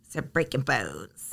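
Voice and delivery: hushed, low spooky whisper. Pretend. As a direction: breathe it low like a ghost story. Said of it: "Shh, shh. Listen".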